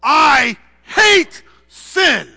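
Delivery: very slow, very loud high shout; Jesus crying out against sin, shouted slowly; anguished hatred. Acted. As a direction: roar it out, dragging every word in disgust. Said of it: "and he's going".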